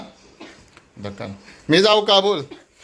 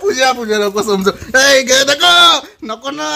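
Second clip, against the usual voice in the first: pleading shout; 5 semitones higher, 6 dB louder, slower than usual. Real, not acted. Husband begs as balm burns.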